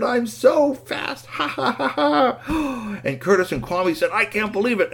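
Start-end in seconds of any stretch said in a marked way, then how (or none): 0.0-3.0 high, slow, stretched boast with a laugh; smug. Acted. Robot smirking at the boys behind him, smug. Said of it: "he just smirked and said". 4.1-4.9 high exasperated exclamation; disbelief. Acted. Boys seeing the robot ahead of them, incredulous. Boys beaten again, amazed.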